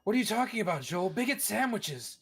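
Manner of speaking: hammed-up villain. Hammed-up bigot sandwiches bit.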